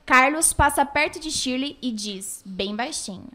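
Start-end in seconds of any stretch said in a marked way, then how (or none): none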